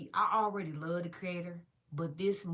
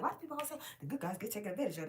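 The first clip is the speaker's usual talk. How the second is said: skeptical retold question; 3 semitones higher, much faster than usual. Mocking her own past disbelief.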